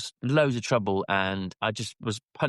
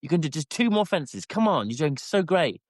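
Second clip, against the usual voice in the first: rally him over the last two fences; higher cheering to the horse; encouraging shout; 6 semitones higher than usual. Retold or imitated. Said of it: "I was like".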